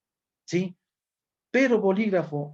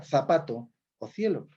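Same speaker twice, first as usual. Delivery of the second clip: Castilian interdental Z and C; Spain ceceo; 2 semitones lower than usual. Shown as a demonstration. Teacher demonstrating Spain's pronunciation to students, Castilian ceceo. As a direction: say it with a Spanish lisped th, as in Spain. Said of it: "tal como pronuncian algunos españoles".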